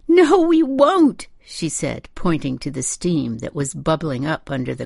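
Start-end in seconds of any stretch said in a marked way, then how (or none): 0.1-1.3 high, loud, slow wail; distraught mother wailing; distraught refusal. A narrator's reading. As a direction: refuse it in a drawn-out high cry. Distraught mother contradicts the reassurance.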